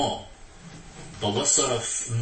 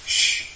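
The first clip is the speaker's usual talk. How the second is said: shushing; 5 dB louder than usual. Retold or imitated. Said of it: "He says".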